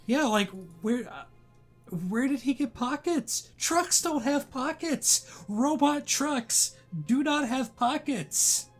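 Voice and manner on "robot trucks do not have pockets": ordinary talk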